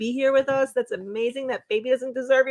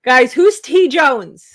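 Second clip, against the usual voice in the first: calling out; 9 dB louder, much slower than usual. Real, not acted. Calling out to chat.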